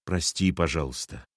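low, soft apologetic mumble; muttered. A narrator's reading. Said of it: "пробормотал Сергей". (тихо пробормотал)

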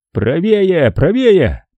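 high, urgent shouted directions; shouting. A narrator's reading. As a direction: call it out urgently. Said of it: "крикнул Эверетт".

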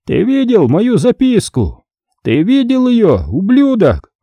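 high, drawn-out voice of a gloating caller; angry, triumphant. A narrator's reading. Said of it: "В голосе звучала злость и что-то ещё". (злобно и торжествующе, высоким голосом)

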